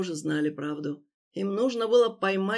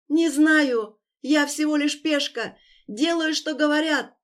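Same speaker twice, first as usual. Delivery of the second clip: desperate crying; 8 semitones higher, 5 dB louder than usual. Acted. Wounded victim pleads.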